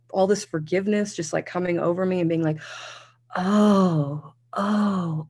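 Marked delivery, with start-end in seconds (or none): 3.4-5.3 higher, softened "oh" of realization; acted dawning relief. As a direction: re-enact tearful release. Retold wave of forgiveness and relief.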